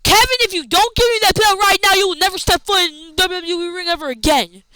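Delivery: lightly acted boss laying down the law; half-played angry ultimatum to a wrestler; mock angry threat. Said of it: "got mad and said".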